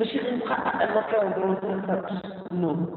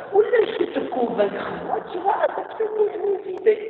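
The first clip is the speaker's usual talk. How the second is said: mock other side; 12 semitones higher than usual. Acted exchange, other party speaking.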